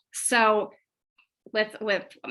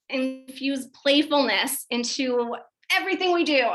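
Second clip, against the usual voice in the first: ordinary delivery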